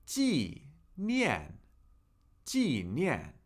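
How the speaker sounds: 纪念 with both syllables sharply falling; two falling tones. Shown as a demonstration. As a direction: drop the pitch hard on each syllable of 纪念. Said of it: "Two fourth tones, two falling tones".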